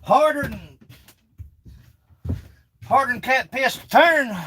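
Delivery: high, loud exclamation; excited. Real, not acted. Exclaiming over hard wood.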